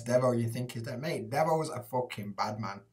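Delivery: ordinary delivery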